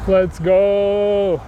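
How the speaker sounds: drawn-out "go" on a held note; excited whoop. Real, not acted. Cyclist setting off down the hill, excited drawn-out cheer. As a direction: cheer it out as you speed off.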